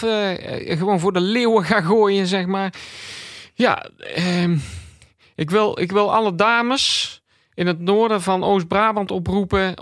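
an awkward local presenter begging for dates; nervous radio host. Acted. Radio host calls on ladies of Oost-Brabant, nervous and eager.

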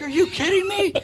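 very high, loud imitation of disbelief; incredulous outburst. Player answering a heckling fan, incredulous retold outburst. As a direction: act out an exasperated, high-pitched protest.

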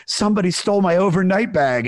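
high, loud mock-distraught complaint; mock crying. Upset date telling him about the stolen bag, mock crying. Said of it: "she's, like, crying".

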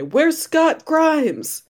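high, loud, drawn-out demand; mock outraged heckling. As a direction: wail it at the awards host. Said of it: "slap them and say".

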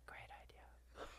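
breathy hushed whisper. Retold or imitated. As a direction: whisper it, awed. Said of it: "And he went".

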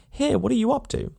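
high, rising cry of annoyance with the stress on Here; "Here" shot up high in exasperation; irritated call. Acted. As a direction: call out at him, fed up. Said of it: "he calls out".

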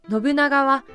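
ordinary talk, nothing marked

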